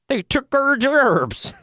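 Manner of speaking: high, slow, garbled mocking cartoon voice; South Park. Co-hosts likening the accent to a cartoon, high mocking voice. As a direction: slur it into an exaggerated mocking whine. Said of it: "voices South Park guys do to mock people".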